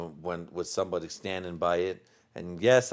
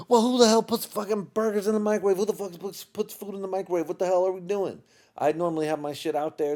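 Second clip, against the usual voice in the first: mock furious rant; 8 semitones higher than usual. Venting to his wife about burgers in the microwave, high mock rage. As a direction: rant like a man robbed of his dinner.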